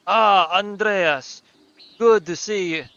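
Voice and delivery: badly faked German accent. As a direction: hail a friend in a shaky attempt at German accent. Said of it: "I don't know how to do German accents very well".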